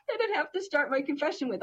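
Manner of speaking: ordinary delivery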